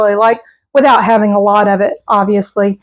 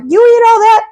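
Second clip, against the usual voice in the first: much higher, shocked disbelief; incredulous viewer; 11 semitones higher, 3 dB louder than usual. Retold or imitated. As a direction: squeal it like a stunned onlooker. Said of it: "Y'all are gonna think this is crazy".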